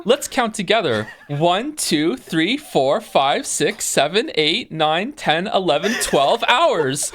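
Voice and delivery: high, loud, singsong preschool host; kindergarten teacher voice. Acted. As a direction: talk to chat like toddlers. Mock kids' show host to chat.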